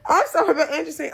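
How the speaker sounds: high laugh spilling into the words; burst of laughter. Real, not acted. Recapper laughing as Angie's friend backs her up.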